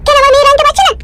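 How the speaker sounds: wife character. Acted. Wife praising husband's new shirt, high female voice. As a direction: play the doting wife, high-pitched.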